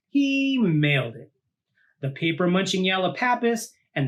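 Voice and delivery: lively read-aloud storytelling voice; storybook narration. A narrator's reading. Host reading a monster picture book to kids, lively storytelling. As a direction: read it out like to a child at bedtime.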